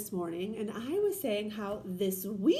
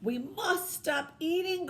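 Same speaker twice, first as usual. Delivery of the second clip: slow, higher cry of we must stop eating; cried; 6 semitones higher, slower than usual. Acted. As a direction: plead with himself to stop. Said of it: "cried Toad".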